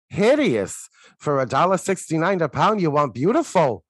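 loud, high, indignant chopped liver monster; acted monster voice. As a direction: bellow it like an offended deli monster. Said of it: "and he goes".

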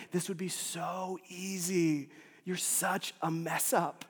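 slow, drawn-out inner critic; taunting voice in the head; self-accusing inner voice. Acted. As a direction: drag out the reproach, lightly hushed.

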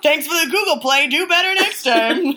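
loud, high sarcastic scolding; a hammed-up nag, pointed and loud; mock nagging. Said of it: "That's how you nag like a pro".

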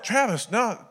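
high-pitched imitation of worried buddies; mock alarm. Friends trying to stop a crying teammate, mock alarm. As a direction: cut in high to calm him.

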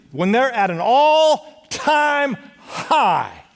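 rising preacher's crescendo; preaching climax. Real, not acted. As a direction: stretch it out high toward the peak. Preacher builds to a peak.

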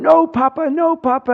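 high-pitched mock plea from a boy; pleading kid. A boy pretending to beg while his grandpa fakes punches. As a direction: cry out like a little boy begging his grandpa. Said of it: "He's going".

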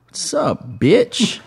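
smirking cat sneering a taunt; snooty bored cat. Retold or imitated. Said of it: "he smirked, and he said".

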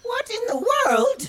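high loud startled cry; shocked. Acted. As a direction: realize you've been fooled. Blackbird discovers trick.

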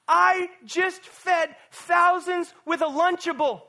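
loud, high, slow exasperated shout; mock exasperated shout. Jesus to his disciples, loud exasperated shout. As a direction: shout it like an incredulous teacher.

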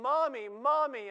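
put-on high, slow, loud child's cry; a preacher's mock child wailing for her mother; child screaming for mommy. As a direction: cry out like a frightened little girl.

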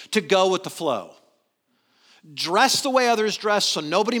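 loud, driving sermon delivery; full-force preaching. Acted. Pastor challenging his church, loud preaching voice. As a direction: press the congregation hard.

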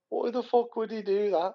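high, drawn-out disbelief; slow, high-pitched mock bewilderment; retold incredulity. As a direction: imitate a mate baffled by nonsense. Imitating laughing mate's incredulous question.

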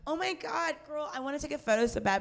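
high, fast, sugary mock friendliness; fake-friendly girl. Plotting to lure the fan away, in a high, fast fake-sweet voice. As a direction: sweet-talk her with sly glee. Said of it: "Like, just be like".

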